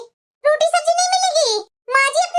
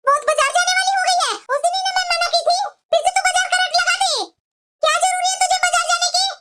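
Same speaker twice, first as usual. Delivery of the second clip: put-on higher, scolding old-woman voice; angry mother-in-law; 5 semitones higher, faster than usual. Mother-in-law refusing the elder bahu a market trip, scolding. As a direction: snap at her like a strict saas.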